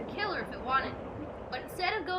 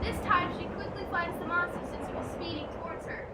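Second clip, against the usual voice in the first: ordinary delivery, nothing marked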